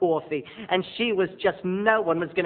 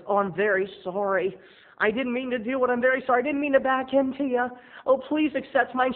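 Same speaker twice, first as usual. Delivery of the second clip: timid apologizing guy; 8 semitones higher than usual. Retold or imitated. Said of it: "And the guy says".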